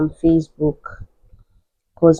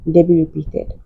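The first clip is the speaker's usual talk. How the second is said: normal talking voice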